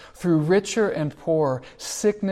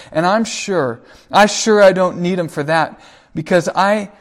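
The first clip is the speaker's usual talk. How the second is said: louder, fast, building retold shouting; 3 semitones higher, 8 dB louder than usual. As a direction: shout it like a hurt son lashing out. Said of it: "then Will begins to shout".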